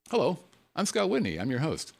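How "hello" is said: ordinary talk, nothing marked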